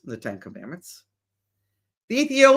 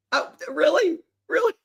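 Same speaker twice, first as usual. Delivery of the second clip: laughing disbelief; 9 semitones higher, faster than usual. Real, not acted. Laughing disbelief at government's excuse.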